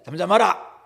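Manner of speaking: loud, high rebuke to the storm; stern command. Acted. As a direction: command the wind to be still. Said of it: "꾸짖습니다". (크게 꾸짖는 명령조)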